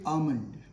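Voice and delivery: 'almond' as ah-mund, no L; silent L. Shown as a demonstration. Teacher models for learners, silent L. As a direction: say 'almond' leaving out the L sound. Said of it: "L is silent here".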